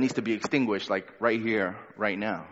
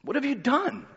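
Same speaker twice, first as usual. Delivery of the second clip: What have you done? pitched much higher and softer, a pressing Pilate; mock demand; 9 semitones higher, 4 dB quieter than usual. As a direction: ask What have you done? high and light, needling. Repeats Pilate's question, voiced high.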